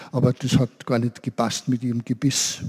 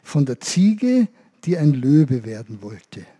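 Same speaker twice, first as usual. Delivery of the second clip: slow title; 2 semitones lower, slower than usual. Acted. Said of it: "meine Lieblingsgeschichte".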